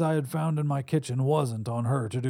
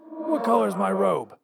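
higher-pitched raspy voice; 8 semitones higher, 3 dB louder than usual. A narrator's reading. Visitor wakes his past self, raspy. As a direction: rasp it out as an older double of yourself. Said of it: "though a bit raspy".

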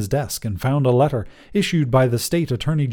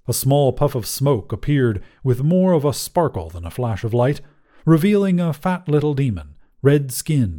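usual speaking voice

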